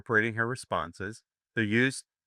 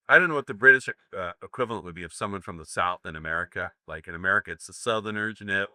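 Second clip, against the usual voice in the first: usual speaking voice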